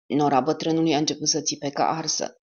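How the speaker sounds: ordinary delivery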